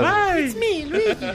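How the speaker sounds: very high, bouncy Italian accent; squeaky cheerful Italian plumber; Luigi voice. Shown as a demonstration. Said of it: "[name] con acento italiano hablando inglés". (voz aguda de Luigi con acento italiano)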